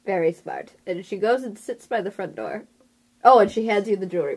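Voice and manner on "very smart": ordinary delivery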